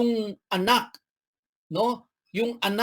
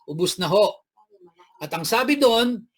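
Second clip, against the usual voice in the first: ordinary talk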